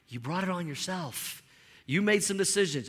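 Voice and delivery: God calmly telling you how it is. Pretend. God's voice answering complaint.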